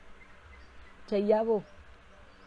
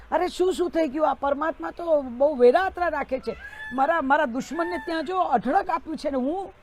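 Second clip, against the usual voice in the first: higher and louder, a desperate man's complaint against God; bitter despairing rant; 5 semitones higher, 4 dB louder than usual. Acted. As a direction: pour out your grievance, raised and pleading. Despairing man rants to sadhu. (ઊંચા મોટા અવાજે નિરાશ ફરિયાદ)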